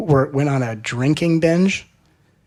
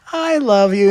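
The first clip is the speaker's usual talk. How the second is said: eager declaration; 9 semitones higher, 7 dB louder than usual. Retold or imitated.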